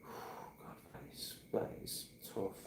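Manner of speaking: breathy whispered sigh at the memory. Real, not acted. Remembering Kambo, sighing.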